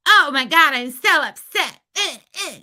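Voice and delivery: hammed-up fake upset; mocking upset whine. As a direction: mimic her fake outrage with a shrill gasp. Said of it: "you and your little".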